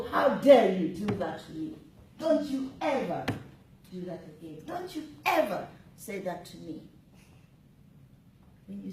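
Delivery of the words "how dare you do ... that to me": furious shout, much louder; loud outraged scolding; angry voice. Shown as a demonstration. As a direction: tear into someone who stepped on your toe. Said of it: "we've got the angry voice".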